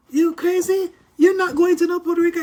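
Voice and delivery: high, loud, fast outraged mother; mother hearing her teen bought a ticket, shouting in shock; shocked mom yelling. Retold or imitated. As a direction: retell mom shouting in disbelief. Mother forbids the trip.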